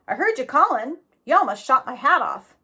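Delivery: a hint of a rough frontier man; gruff stranger. A narrator's reading. Stranger questioning Jack down in the hole, lightly voiced. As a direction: voice the big stranger, mildly gruff.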